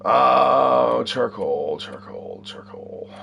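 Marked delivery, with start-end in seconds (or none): 1.0-3.1 soft unvoiced whisper, repeating the word as he searches; whispered to himself. Real, not acted. Whispering to himself while hunting for charcoal. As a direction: breathe the word out while looking around.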